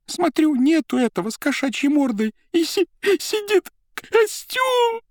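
high, loud frantic retelling; trembling agitation. A narrator's reading. Secretary clutching the accountant's sleeve, high frantic telling. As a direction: pour the whole story out in a panic. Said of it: "трясясь от волнения".

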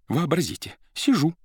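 high, breathless agitation; shaking with agitation. A narrator's reading. Secretary tells the accountant, high agitated voice. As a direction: tremble as you begin the tale. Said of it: "трясясь от волнения".

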